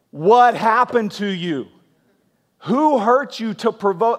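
shocked husband. Acted. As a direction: press each question in stunned concern. Husband reacting to wife's vengeful prayer.

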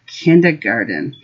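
ordinary delivery

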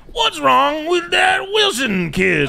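high, drawn-out cartoon kid voice; Fat Albert. Comic acts out a boy asking his teacher, high cartoon impression. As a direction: stretch it out in a high put-on voice. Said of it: "Fat Albert, it goes to his teacher".

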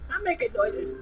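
high, gleeful cry; delighted to make noise; excited squeal. Real, not acted.

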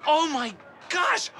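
high, drawn-out shout of amazement; starstruck. Acted. Fan meeting his idol by chance, starstruck. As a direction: cry out slowly in high amazement.

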